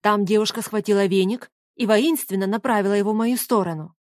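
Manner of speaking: plain reading voice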